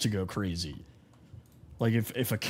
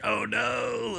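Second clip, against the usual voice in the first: high, drawn-out comic wail of regret; mock dismay; 9 semitones higher than usual. Realizing he hurt his friend's brother, high mock dismay. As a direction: act out guilty horror in a high voice. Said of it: "I felt so bad".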